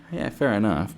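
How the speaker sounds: usual speaking voice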